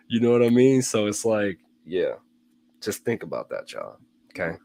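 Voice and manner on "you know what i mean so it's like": normal talking voice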